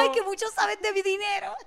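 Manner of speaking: high sugary mock mom, laughing through it; mock motherly praise with a laugh; doting mom voice. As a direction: fawn over him like a proud mother. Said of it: "yo quería lanzar el move".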